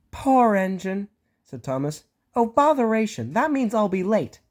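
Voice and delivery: high, fussy and impatient engine; an engine tutting about being late; Thomas's voice. Acted. Thomas fretting he'll be late, high and fussy.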